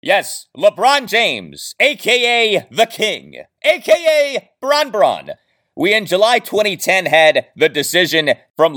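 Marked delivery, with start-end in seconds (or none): none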